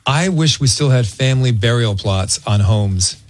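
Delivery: normal talking voice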